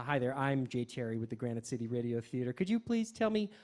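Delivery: usual speaking voice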